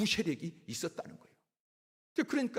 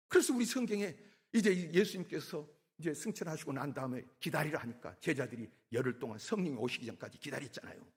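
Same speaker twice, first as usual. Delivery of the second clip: usual speaking voice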